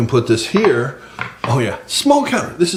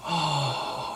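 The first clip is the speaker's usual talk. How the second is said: breathy admiring whisper; 2 semitones higher, 12 dB quieter, slower than usual. Acted. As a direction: whisper it like a secret. Hushed admiration of tacos.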